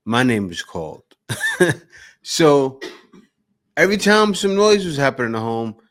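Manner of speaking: ordinary talk, nothing marked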